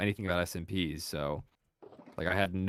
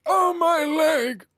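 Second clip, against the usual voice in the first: put-on high, slow pained old man yelp; 8 dB louder, much slower than usual. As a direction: wail it like a hurt old man. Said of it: "I could do my favorite voice actor".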